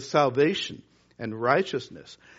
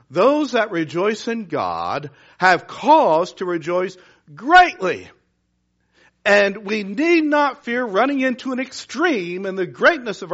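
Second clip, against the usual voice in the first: full-force preaching; 2 semitones higher, 4 dB louder than usual. Acted. Urging the church to rejoice greatly, loud and exultant.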